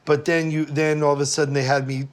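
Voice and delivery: usual speaking voice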